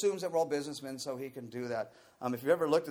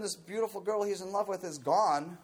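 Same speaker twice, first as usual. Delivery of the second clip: ordinary talk, nothing marked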